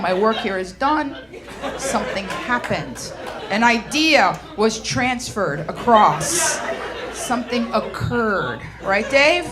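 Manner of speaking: lightly voiced man, low and measured; slow, low mock-male report of a finished task; task-oriented man. Imitating a task-oriented man communicating.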